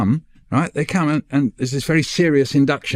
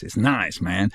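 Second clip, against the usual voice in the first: low, softer "it's nice, man" in a mock American accent; 5 semitones lower, 5 dB quieter, slower than usual. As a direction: drop into a laid-back American accent for "nice". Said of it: "you think Americans say nice".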